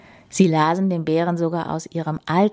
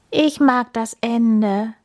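plain reading voice

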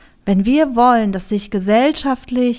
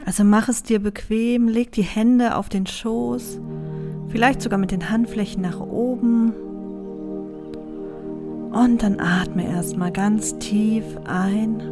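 soft spa whisper; 2 semitones lower, 6 dB quieter than usual. Acted. Leads a meditation over music. (ruhige, langsame Meditationsstimme)

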